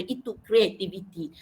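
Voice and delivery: usual speaking voice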